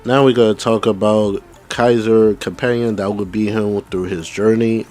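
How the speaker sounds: ordinary talk, nothing marked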